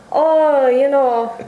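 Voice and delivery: put-on weak Irish accent, barely there; bad Irish accent. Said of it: "that's the worst Irish accent ever".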